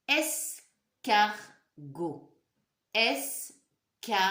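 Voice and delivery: escargot stretched out slowly; slow syllable-by-syllable. Shown as a demonstration. Teacher to pupils, slowly sounding out the word. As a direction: sound out each syllable for the class. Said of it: "Qu'est-ce que j'entends?".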